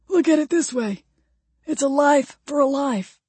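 high-pitched plea; imploring. A narrator's reading. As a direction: plead for a fair bargain. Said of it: "Ray implored".

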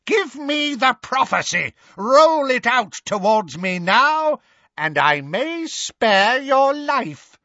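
high, slowing threat; commanding shout. A narrator's reading. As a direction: demand it in a high, menacing cry. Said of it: "shouted Bellatrix".

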